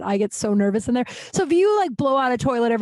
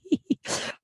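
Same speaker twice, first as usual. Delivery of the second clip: light laugh; 9 semitones higher, 6 dB quieter than usual. Real, not acted. Laughs after joking about fake accounts.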